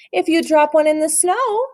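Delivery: a lightly voiced grandma cautioning the boy; warning. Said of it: "she warned".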